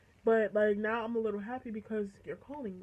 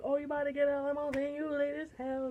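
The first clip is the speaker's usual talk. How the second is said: quick, higher-pitched mimicry; hyped-up friend; 4 semitones higher than usual. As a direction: rattle it off as the lit friend. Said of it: "One friend that's lit as hell".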